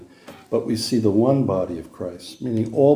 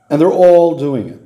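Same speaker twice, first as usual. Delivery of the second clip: "all" punched louder; louder, with the weight on "all"; stress on all; 3 semitones higher, 6 dB louder than usual. Real, not acted. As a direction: lean hard on "all" in "they're all doing it". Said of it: "what's emphasized here... the word all".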